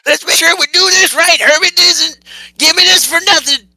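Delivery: very high, loud character voice; high-pitched shouting taskmaster; put-on bossy character. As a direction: give orders in a high, shouted cartoon voice. In-character order to the party.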